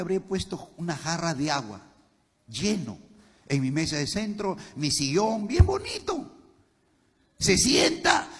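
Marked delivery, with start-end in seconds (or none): none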